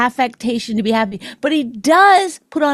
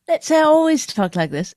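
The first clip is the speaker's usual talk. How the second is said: lightly put-on British accent, pitched higher; fake British accent; 5 semitones higher than usual. Shown as a demonstration. Wife demonstrates always talking with an accent, fake British. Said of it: "you're doing the British accent".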